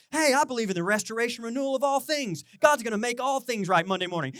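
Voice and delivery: high, loud preaching at full tilt; mock sermon delivery. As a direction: proclaim it like a fired-up pastor. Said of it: "I just gave a full sermon".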